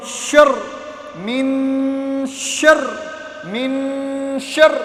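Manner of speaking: slow, stretched من شر with a firm doubled ر; teaching tashdid, slow and firm; doubled letter read firmly. Shown as a demonstration. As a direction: drill the شدّ in من شر, drawn out. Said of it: "تشدید والا word سختی کے ساتھ پڑھا جاتا ہے".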